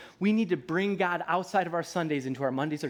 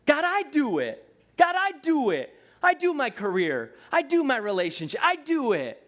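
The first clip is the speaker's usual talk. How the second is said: put-on high, louder insistent child voice; stubborn toddler; 9 semitones higher, 4 dB louder than usual. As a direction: plead your independence like a three-year-old. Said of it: "what do we say to God?".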